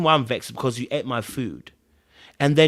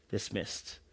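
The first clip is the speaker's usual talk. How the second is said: whispered repeat; 5 semitones lower, 16 dB quieter than usual. Shown as a demonstration. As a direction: whisper the word Dismissed, almost breathless. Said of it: "it's meant to be dismissed".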